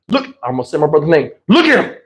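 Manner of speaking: ordinary talk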